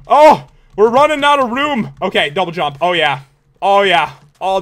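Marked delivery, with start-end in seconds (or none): 0.1-1.9 high, loud burst of alarm; alarmed cry. Real, not acted. Gamer running out of room, alarmed. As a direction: yelp out a sudden warning.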